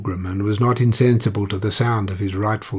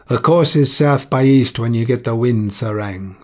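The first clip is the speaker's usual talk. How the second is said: each word spoken out clearly and firmly, louder; distinct; 6 dB louder than usual. A narrator's reading. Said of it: "said Shaw distinctly".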